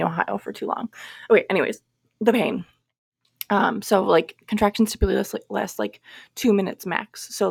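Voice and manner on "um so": normal talking voice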